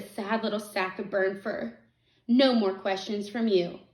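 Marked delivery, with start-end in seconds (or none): none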